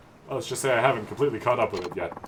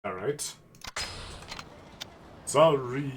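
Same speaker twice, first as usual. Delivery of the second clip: normal talking voice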